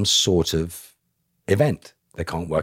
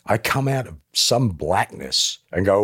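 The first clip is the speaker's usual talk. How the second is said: normal talking voice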